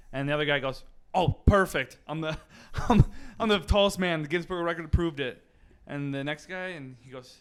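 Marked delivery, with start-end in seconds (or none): 1.1-5.4 louder, higher cry of delight; triumphant. Acted. Second record hopeful celebrates his proven height, triumphant. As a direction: crow about the win.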